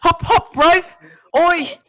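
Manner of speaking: high, loud, airy mock Aussie accent; mock Australian kangaroo. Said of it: "I mean".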